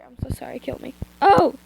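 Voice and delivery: plain reading voice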